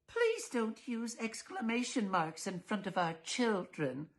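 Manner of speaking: high, slow, prissy reproach; prim fussy wife. Acted. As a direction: scold the neighbor primly. Missus Dullard reproaching.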